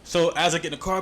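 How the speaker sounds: normal talking voice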